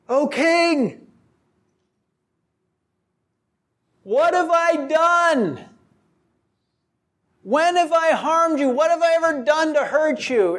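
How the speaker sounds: calling across a field. Retold or imitated. David calls across a field to King Saul, pleading shout. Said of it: "he's yelling across a field".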